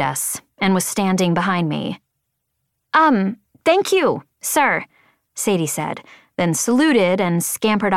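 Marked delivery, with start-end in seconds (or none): 2.9-4.8 high, slow, hesitant thanks; high-pitched, awkward young recruit; flustered subordinate. A narrator's reading. Sadie thanking her flight leader, flustered.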